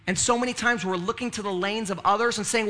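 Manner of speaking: ordinary talk, nothing marked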